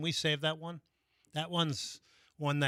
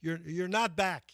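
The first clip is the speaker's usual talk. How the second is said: louder, slow mock yell of "not back"; 3 semitones higher, 6 dB louder, slower than usual. Host imitating a neighbour yelling from his backyard, louder and slow. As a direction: call it over the fence, drawn out. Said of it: "he's just yelling out".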